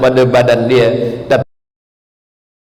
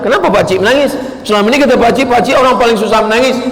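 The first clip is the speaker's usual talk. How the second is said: puzzled ustaz; 8 semitones higher, faster than usual. Retold or imitated. Ustaz questioning the old man.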